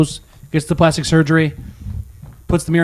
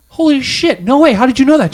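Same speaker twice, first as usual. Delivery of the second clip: stunned disbelief; 9 semitones higher than usual. Retold or imitated. Old man amazed the blind lady guessed his age. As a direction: blurt it out floored by her guess.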